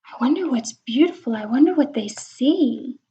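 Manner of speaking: plain reading voice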